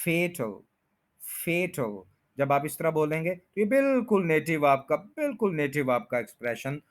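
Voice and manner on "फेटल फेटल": फेटल with long "ay", as FAY-tl; correct fatal. Shown as a demonstration. Teacher giving the correct word, फेटल as FAY-tl. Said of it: "फे कहना".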